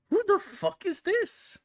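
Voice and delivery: high, slow and baffled; baffled outburst. Real, not acted. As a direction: squeal out total confusion. Confused by a guessing clue.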